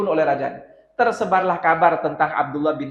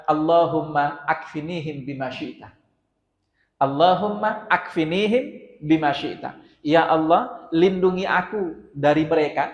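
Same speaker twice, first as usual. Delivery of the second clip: slow, hushed supplication to God; pleading prayer; 3 semitones lower, 3 dB quieter than usual. Acted. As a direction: pray slowly for rescue, lowering the voice. Prays for rescue on mountaintop.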